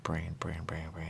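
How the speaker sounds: fading into a whisper; muttering to remember. Real, not acted. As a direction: repeat it softly to memorize. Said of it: "I gotta remember that word".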